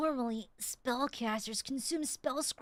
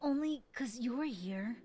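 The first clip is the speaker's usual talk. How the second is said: put-on anime boy voice; 4 semitones lower, 3 dB quieter than usual. Shown as a demonstration. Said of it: "generic, like, anime boy voice".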